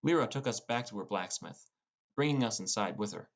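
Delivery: ordinary talk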